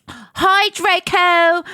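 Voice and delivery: high, drawn-out bright hello; cheery greeting, not depressed. Acted. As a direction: call it out in a high, eager voice. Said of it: "oh, I said in depressed voice".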